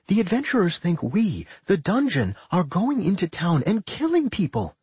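a hint of a thoughtful fairy; lightly voiced fairy. A narrator's reading. Claire voiced by narrator.

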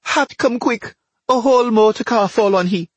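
high, urgent cry to a neighbour; panicked wife shouting for help; calling for help. Acted. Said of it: "Missus Baku called".

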